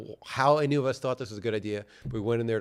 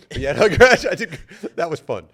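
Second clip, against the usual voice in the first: usual speaking voice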